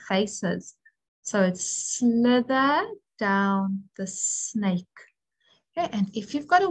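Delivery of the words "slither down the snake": slither down the snake, slow and sing-song; rhyme for the letter. Shown as a demonstration. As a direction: draw out slither down the snake like a chant. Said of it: "we can maybe say a saying like".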